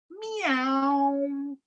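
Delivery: high, drawn-out meow; a long lazy meow; cat meow. Acted. Reading to children, a high stretched meow.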